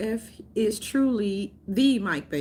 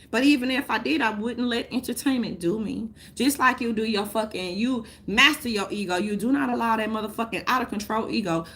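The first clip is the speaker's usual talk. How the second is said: ordinary delivery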